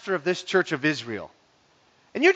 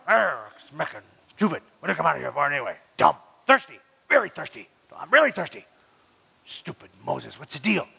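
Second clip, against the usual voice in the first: low mock grumbling under the breath; 5 semitones lower than usual. Shown as a demonstration. Thirsty Israelite muttering about stupid Moses, grumbling. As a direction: grumble to yourself in broken little bursts.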